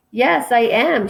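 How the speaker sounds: mock sob pushed up in pitch; sobbing line, acted. A narrator's reading. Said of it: "she sobs".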